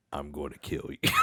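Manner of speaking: lightly voiced menacing dream voice; a hint of a deep villain's threat. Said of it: "hears this even deeper voice".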